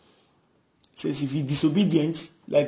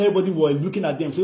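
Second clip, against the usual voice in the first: ordinary delivery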